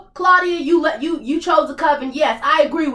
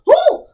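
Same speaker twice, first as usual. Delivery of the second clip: exasperated whoop; 14 semitones higher, 6 dB louder than usual. Real, not acted. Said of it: "Motherfucker, I'm pissed".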